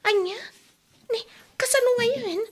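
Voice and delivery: high tearful cry of dismay; distressed crying. Acted. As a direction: break into a worried cry, pitched high. Wife shocked money is gone.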